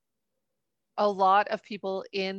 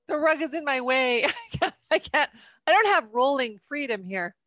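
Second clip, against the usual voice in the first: normal talking voice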